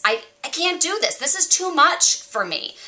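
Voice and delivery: high, strained, self-doubting complaint; whiny inner voice. Retold or imitated. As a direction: plead that it is too hard. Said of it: "one telling me I can't".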